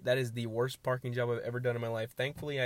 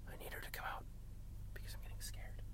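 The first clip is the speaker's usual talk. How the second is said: hushed, barely audible confiding; nervous whisper; 16 dB quieter, faster than usual. Real, not acted. Driver waiting for friend is getting scared, whispering. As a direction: whisper it to yourself, uneasy.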